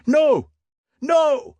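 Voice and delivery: very high, loud yell; frantic shout. Acted. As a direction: scream it as he runs off. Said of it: "shouted the Stabs".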